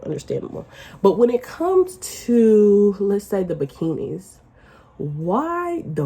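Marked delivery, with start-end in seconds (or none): 0.0-0.6 understandable breathed out without voice; a soft whispered aside to herself. Real, not acted. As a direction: drop to a whisper on the repeat. Whispered repeat of concession.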